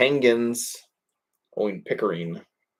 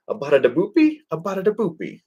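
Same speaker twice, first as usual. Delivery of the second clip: hammed-up fake Italian, pitch climbing much higher on the repeat; imitating a cartoon character's fake Italian, louder and then much higher; mock Italian gibberish; 9 semitones higher, 4 dB louder, faster than usual. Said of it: "trying to speak like Italian".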